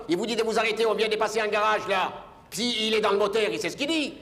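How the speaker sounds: high, pushy argument; heated pleading. Retold or imitated. Passenger arguing with the driver, high and urgent. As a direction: press the driver, getting worked up.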